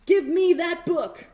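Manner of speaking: put-on loud, slow, bossy kid demand; a kid snatching a book, loud and slow; demanding shout. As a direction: bark it like a grabby little boy.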